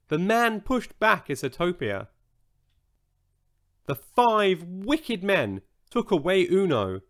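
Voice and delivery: ordinary delivery, nothing marked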